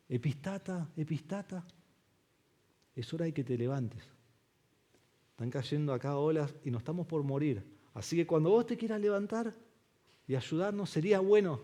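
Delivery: soft, leisurely mock request; politely nudging, no urgency; mock calm quiet. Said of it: "Entonces lo sacude". (ruego tranquilo y burlón en voz baja)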